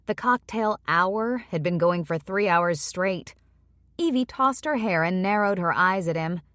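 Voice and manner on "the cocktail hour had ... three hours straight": usual speaking voice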